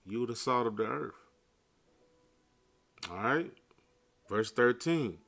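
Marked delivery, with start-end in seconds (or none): none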